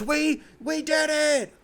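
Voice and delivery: high, slow, drawn-out squeal of joy; mock Tatum celebration. Mocking the star's celebration, a high slow squeal. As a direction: stretch it out like a giddy champion. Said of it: "goes out there and goes".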